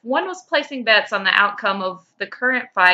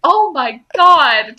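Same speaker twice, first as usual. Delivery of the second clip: slow, loud despairing oh my God; a wizard despairing at his teammates; exasperated cry; 6 semitones higher, 11 dB louder, much slower than usual. Acted. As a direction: wail it in disbelief. Taco exasperated.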